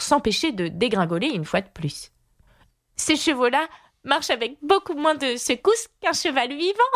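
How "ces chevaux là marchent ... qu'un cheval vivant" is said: put-on higher girl's voice breaking into a giggle; small burst of laughter. A narrator's reading. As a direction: let a little laugh escape at the end. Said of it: "en laissant fuser un petit éclat de rire". (fillette qui pouffe de rire)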